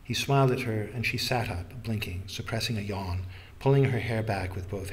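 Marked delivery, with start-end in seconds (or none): none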